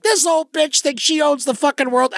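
high, contemptuous jab; retold scornful outburst. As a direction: sneer at the old lady. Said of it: "I was just like".

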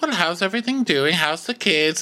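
a hint of a genial old king making small talk; lightly voiced cheery king. Voicing king greeting his family.

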